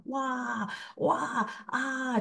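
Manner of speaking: drawn-out high wah sounds; tongues outburst demo. Shown as a demonstration. Demonstrating her tongues to the congregation, high drawn-out wah cries. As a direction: show the wordless cry of the new tongues. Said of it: "我的方言就是大声、大声".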